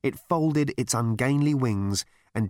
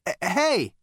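loud, high hail; calling out; 9 semitones higher, 5 dB louder than usual. A narrator's reading. Zaphod to the departing voice, calling out. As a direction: call after someone leaving. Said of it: "called out Zaphod".

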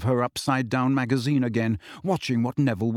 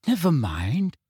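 mildly brisk encouragement; bracing; 3 semitones higher than usual. A narrator's reading. Ginny consoling Harry over the stink sap, bracingly. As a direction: buck him up a little. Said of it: "said Ginny bracingly".

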